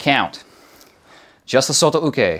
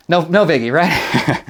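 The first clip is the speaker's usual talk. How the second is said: ordinary talk, nothing marked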